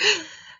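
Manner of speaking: high, sharp shocked intake of breath; sharp gasp. Retold or imitated. Reader hit by the surprise ending, sharp shocked gasp. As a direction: gasp as if the twist just hit you. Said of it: "And you're like".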